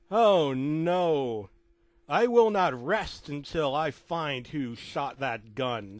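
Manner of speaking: slow, drawn-out mock-heroic vow; hammed-up solemn outrage; melodramatic vow. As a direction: declaim it slowly with a loud opening wail. O J's mock vow after shooting.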